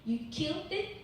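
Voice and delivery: mock-accusing question; imagined accusation. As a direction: voice the children's accusing look, pitch rising. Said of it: "looking at me like".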